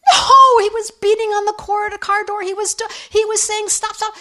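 high-pitched cry of distress, loud at first; anguished protest. Retold or imitated. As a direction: act out a friend's horrified, urgent correction. Friend protests, imagining Jesus' anguish.

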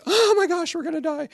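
mock panic. Mock horror-movie panic.

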